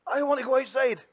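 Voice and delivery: put-on high pitched child pleading; child whine. Shown as a demonstration. Minister gives the next child a whiny line. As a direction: whine to be let outside. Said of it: "Need a couple more".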